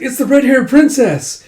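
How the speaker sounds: very high, loud fan-like cry; excited exclamation. Acted. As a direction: announce the princess with great excitement. Soldiers excited at seeing princess.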